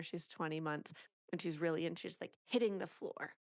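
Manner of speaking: ordinary delivery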